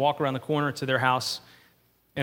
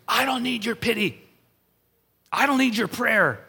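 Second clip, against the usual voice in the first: higher, sharp mock rejection; retold angry rebuff; 6 semitones higher than usual. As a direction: throw back the offer of pity. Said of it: "he was furious with me".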